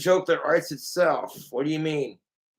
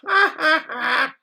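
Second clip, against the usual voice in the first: high, loud burst of laughter; 11 semitones higher, 4 dB louder than usual. Real, not acted. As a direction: let out a big belly laugh. Puppet cracking up at joke.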